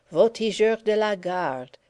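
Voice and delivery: French war cry, called out; French shout. A narrator's reading. De Lapp shouting a French war cry, wild and elated. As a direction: cry it like a guardsman charging. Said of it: "he shouted".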